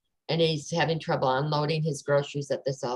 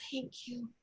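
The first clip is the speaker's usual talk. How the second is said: soft thank you; 6 semitones higher, 17 dB quieter than usual. Retold or imitated. Cashier thanks her, quietly moved.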